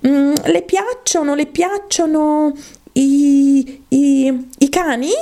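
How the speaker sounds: high, halting question; drawn-out, tentative heroine voice; Alice, hesitant. Acted. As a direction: stall and stammer while changing the subject.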